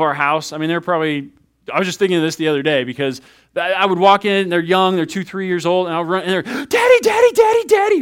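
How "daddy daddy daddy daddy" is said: put-on squealing little kids, much higher; high, excited toddler calls; little children shouting for daddy. Kids running to greet dad.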